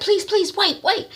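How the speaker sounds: high urgent begging; pleading. Retold or imitated. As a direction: stop the wife. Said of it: "T Y is like".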